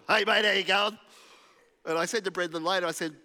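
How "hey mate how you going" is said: loud, matey Aussie tradie greeting. Retold or imitated. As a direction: call out like a builder on site. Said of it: "I generally talk like a tradie to 'em".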